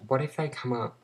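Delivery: ordinary talk, nothing marked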